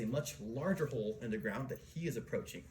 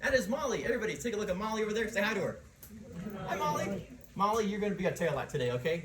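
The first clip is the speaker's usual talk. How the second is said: calling out; 9 semitones higher, 7 dB louder than usual. Real, not acted. Guide calls to volunteer at back.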